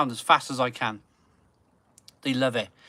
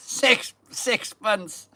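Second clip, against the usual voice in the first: high breathy laugh through the words; laughing disbelief; 8 semitones higher, 5 dB louder, much slower than usual. Real, not acted. After six months of digging he laughs at himself, laughing. Laughing at his own hard work.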